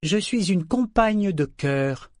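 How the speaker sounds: measured dignified claim; slow proud statement. A narrator's reading. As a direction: state her title with pride. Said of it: "fit remarquer Série".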